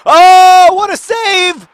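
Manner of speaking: very high, loud shout of joy; delighted shout. Real, not acted. Host cheering a goalkeeper save, loud joyful shout.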